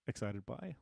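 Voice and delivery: ordinary talk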